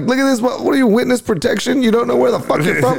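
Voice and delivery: high, mock-outraged incredulity; roasting a stumped guy in disbelief; incredulous roast. Comic roasting the flustered audience member, high and incredulous.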